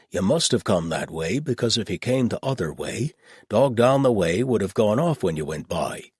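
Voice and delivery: matter-of-fact rustic reasoning; gruff old shepherd. Acted. Old shepherd working out where the strangers came from, plainspoken.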